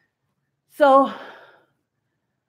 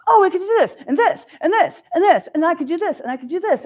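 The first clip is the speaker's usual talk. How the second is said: high, loud, tumbling ideas; waking up with ideas flooding in, high and loud; excited rush; 9 semitones higher, 7 dB louder, faster than usual. Retold or imitated. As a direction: let the ideas spill out in a burst. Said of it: "And I'm like".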